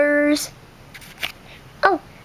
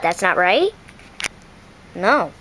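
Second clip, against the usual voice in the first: a chicken correcting the duck; low chicken voice; 8 semitones lower than usual. Acted. Chicken telling the duck it got it wrong, low. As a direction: lower voice, questioning then flat no.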